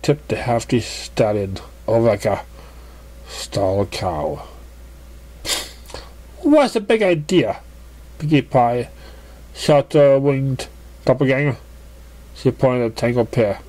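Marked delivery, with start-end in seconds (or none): 6.4-7.6 what's the big idea. A narrator's reading. Pinkie indignant at collision.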